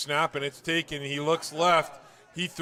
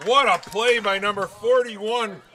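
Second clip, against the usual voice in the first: much higher and louder, building to a shout; excited; 7 semitones higher, 10 dB louder than usual. Real, not acted. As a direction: call the big play at full thrill. Live call of fumble recovery.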